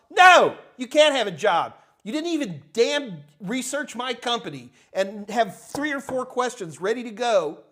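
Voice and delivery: loud, high-pitched vehement refusal; exasperated boss on a soapbox; vehement rejection. Acted. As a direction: turn the applicant down with real force. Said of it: "I'm just vehement".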